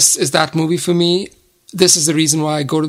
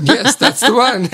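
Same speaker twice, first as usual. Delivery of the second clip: slow, higher, laughing reply; 7 semitones higher than usual. Real, not acted. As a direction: giggle through the words. Laughs excitedly.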